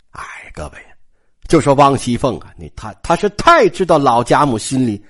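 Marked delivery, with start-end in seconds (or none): 0.1-0.8 hushed whisper to the audience; whispered aside. Real, not acted. Storyteller whispers an aside to listeners, hushed. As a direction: drop to a whisper as if sharing a secret.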